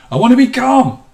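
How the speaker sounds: said high and loud, strained; tense say. Shown as a demonstration. Teacher demonstrating the un-calming way, high and loud. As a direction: blurt it out tense and keyed up. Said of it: "say it in a way that is calming".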